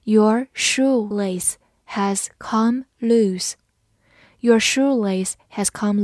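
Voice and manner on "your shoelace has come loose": drawn-out model sentence with pauses between words; slow reading. Shown as a demonstration. Teacher modelling the shoelace sentence for learners, slow reading. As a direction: read slowly, word by word. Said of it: "我们慢读一遍".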